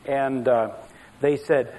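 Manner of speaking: ordinary talk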